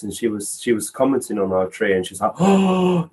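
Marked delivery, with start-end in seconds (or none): 2.4-3.1 high, drawn-out "oh" of delight; mock wonder. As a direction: imitate a child's wonder. Child marvels at the tree.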